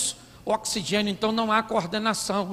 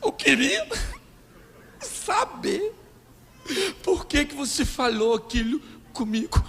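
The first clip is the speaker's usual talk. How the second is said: sobbing, high broken words, hammed up; mock crying; 5 semitones higher than usual. Wife after the argument questioning her husband, exaggerated crying. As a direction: whimper it out in pieces, badly faked tears. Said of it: "ela começa a chorar".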